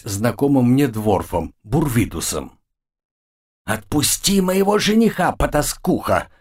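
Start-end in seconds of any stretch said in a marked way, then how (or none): 3.7-6.3 high, furious hissed insult. Acted. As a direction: spit out the insult in rage. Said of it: "громко шипела Лия".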